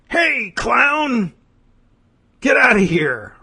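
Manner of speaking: high, acted warning shout; mock shout. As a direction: shout to chase someone off. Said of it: "they yell something at you".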